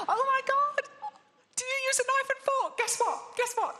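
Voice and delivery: hammed-up delighted squeal of a new couple; gushing over-excited date. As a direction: squeal every word like he's a miracle. Mocking gushing date agreeing.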